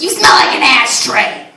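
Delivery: a hint of a disgusted old crone; mock snooty disgust, slowed down; rude old lady.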